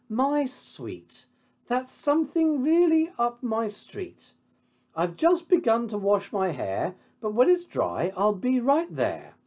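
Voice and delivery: high, assured lady's voice. Acted. Heroine promises Pig she will come, high and self-assured. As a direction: reassure sweetly in a high register.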